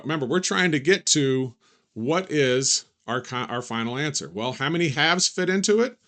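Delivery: ordinary talk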